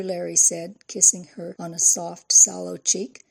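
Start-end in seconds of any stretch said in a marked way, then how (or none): none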